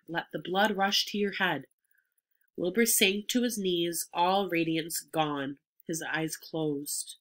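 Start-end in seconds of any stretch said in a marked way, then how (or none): none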